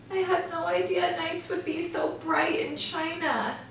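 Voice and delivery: lightly squeaky mouse, sighing; high weary sigh. Acted. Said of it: "he sighed to Luna Moth".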